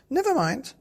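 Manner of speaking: very high, loud cheery mother voice; Mummy Bear. Acted. Mummy Bear greeting Daddy Bear, high cheery voice. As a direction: sing it out brightly. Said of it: "said Mummy Bear".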